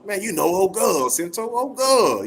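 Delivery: put-on high, slow Southern drawl on "old girl". Man shows Sento how Southern folks say "old girl", slow drawl. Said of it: "that's a Southern thing".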